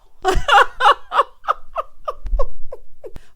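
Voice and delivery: high, loud burst of cackling; real long laughing fit. Real, not acted. Host cracking up at the jail line, loud laughter.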